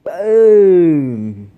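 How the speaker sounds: loud triumphant boom; excited. Real, not acted. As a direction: shout it out in triumph. Triumphant after packing the can.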